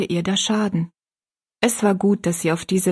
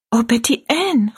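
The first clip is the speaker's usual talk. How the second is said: dismayed cry; 4 semitones higher, 3 dB louder than usual. Acted. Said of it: "rief Rosalie ganz bestürzt aus".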